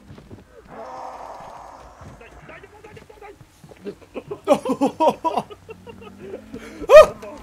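shrieking laugh of real panic; panicked screaming laugh. Real, not acted. Streamer cornered by the goat, screaming laugh. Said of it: "Er det du som roper?".

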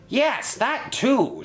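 high, drawn-out exclamation; indignant shout. A narrator's reading. Inspector accepts Henry's insult, shouting. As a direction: snap back at high pitch, stretching the words. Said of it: "shouted the inspector".

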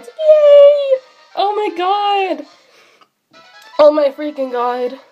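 usual speaking voice

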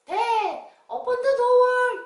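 high, loud, drawn-out call; child calling Dad. Acted. Child outside calling to dad, high loud call. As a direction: shout up sweetly to be let in.

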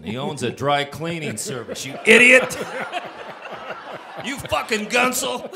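high, loud laughing quote; squealing with laughter at the punchline; laughing through it. Retold or imitated. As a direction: crack up as the insult lands. Retelling Ian's insult, cracking up.

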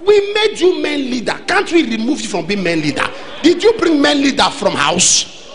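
high, emphatic rebuke from the pulpit; forceful preaching. Real, not acted.

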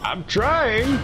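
high, strained protest. Real, not acted. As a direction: push back under strain. Under pressure in game.

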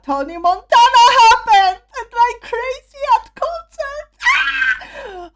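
high, rising shout into a scream; ecstatic squeal. Real, not acted. Fan exclaims that it happened.

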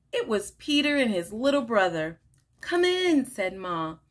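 animated picture-book read-aloud with lively character lines; reading to children. Acted. Teacher reading a picture book to children, bright and animated. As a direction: read it like story time for little kids.

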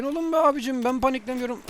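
high, loud flustered protest; panicked denial. Real, not acted. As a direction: insist you're calm while clearly panicking. Panicking while denying it.

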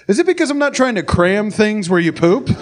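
hammed-up naive asking; mock sincere. Sarcastic imagined question.

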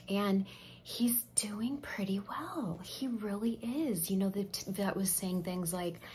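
ordinary talk

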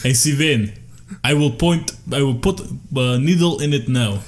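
deliberate mock Russian nurse; Russian accent. Co-host playing the blood-test nurse, Russian accent. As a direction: declare each step in a heavy Russian accent. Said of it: "exactly what she did".

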